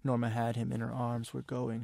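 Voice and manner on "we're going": plain reading voice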